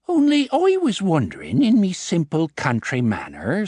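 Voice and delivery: sweet country colleen in stage Irish; secretary feigning innocence in stage Irish accent. A narrator's reading. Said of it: "dropping the stage Irish accent".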